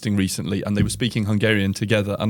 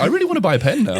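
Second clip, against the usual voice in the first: laughing mock voice; 10 semitones higher, 3 dB louder, much faster than usual. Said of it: "They're like".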